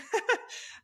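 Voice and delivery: airy high laugh; breathy laugh. Real, not acted. Laughs at husband's offer.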